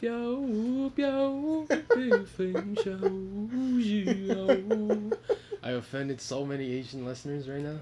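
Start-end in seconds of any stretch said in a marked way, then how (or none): 0.0-5.2 high, drawn-out fake Chinese singsong; mock Chinese accent. Clowning for co-host about lacking the accent, mock Chinese accent. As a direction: stretch out a hammed-up Chinese accent, pitched way up. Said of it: "you don't have the accent".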